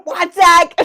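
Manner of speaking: yelling. Real, not acted. Co-hosts bantering over the chat, yelling. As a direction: yell it out abruptly. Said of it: "Why are you yelling?".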